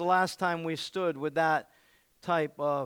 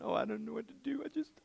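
higher, softer, defeated whine; lightly voiced whimper; mock whining; 6 semitones higher, 6 dB quieter than usual. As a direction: mimic a self-pitying complainer. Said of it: "Instead of going".

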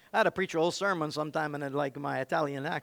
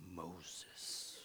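much lower, soft Moses; deep godlike Moses; 12 semitones lower, 21 dB quieter than usual. Shown as a demonstration. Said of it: "it gets deep".

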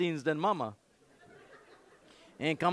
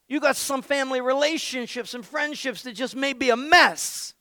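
much louder, higher sermon climax; rally the congregation; fiery preaching; preaching at full force; 8 semitones higher, 8 dB louder than usual. Real, not acted. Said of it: "preaching at full force".

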